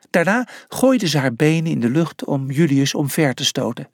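usual speaking voice

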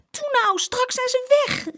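very high, loud urgent plea; calling out. A narrator's reading. Girl urging her tired brother on, urgent cry. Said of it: "riep Saskia".

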